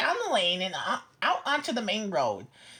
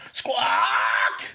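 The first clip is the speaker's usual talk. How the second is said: loud, much lower squawk; chicken squawk; 4 dB louder than usual. A narrator's reading. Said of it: "said Chicken".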